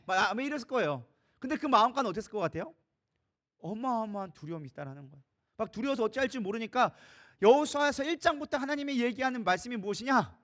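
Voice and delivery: usual speaking voice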